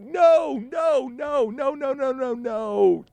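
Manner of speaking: high, drawn-out cry of denial; mock wailing dismay. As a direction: wail it in comic despair. Retold despair at radio news.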